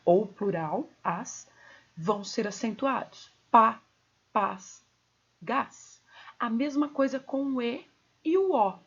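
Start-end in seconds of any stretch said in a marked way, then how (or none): 3.5-6.0 "Pá, paz, gás" separately, each with a full open A; strong open monosyllables. Shown as a demonstration. Teacher lists tonic monosyllables, strong open vowels. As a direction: punch "Pá", then space out "paz" and "gás". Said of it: "pronunciados forte".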